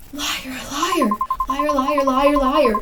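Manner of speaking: wailing, mounting accusation; accusing. Acted. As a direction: hurl the word over and over, building. Ghost accuses the listener of betrayal.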